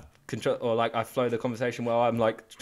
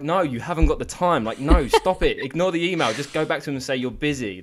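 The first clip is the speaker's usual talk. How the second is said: bossy friend telling off; 4 semitones higher, 7 dB louder than usual. Retold or imitated. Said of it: "I'd be like".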